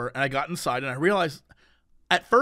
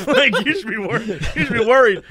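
higher, words broken by laughter; laughing retort; 4 semitones higher, 4 dB louder than usual. Retold or imitated. Teller mocking the old lady's corona worry, laughing. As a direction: laugh through the warning.

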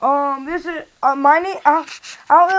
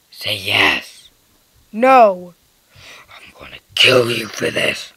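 much lower, breathy threatening whisper; deep whisper voice; slower than usual. Pretend. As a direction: menace them in a low whisper. Beard character whispers threats.

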